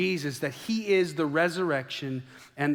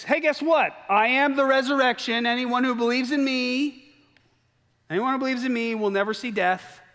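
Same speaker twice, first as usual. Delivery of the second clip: high, loud, cocky announcement; boastful stranger; 8 semitones higher, 4 dB louder than usual. Pretend. A nobody from Reno announcing he is the resurrection, high and loud. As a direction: proclaim it like a showoff from nowhere. Imagined stranger making crazy claims.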